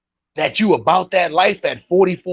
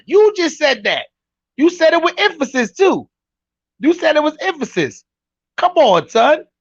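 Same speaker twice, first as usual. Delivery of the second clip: higher, animated ribbing; excited teasing; 7 semitones higher than usual. Real, not acted. Host calling out the streamer, excited teasing. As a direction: call him out playfully.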